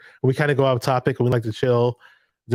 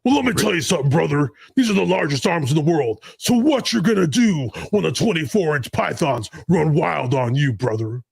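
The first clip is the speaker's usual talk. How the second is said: hammed-up wrestling promo voice; Hulk Hogan. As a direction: cut a swaggering promo at a rival. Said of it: "Talk like Hulk Hogan?".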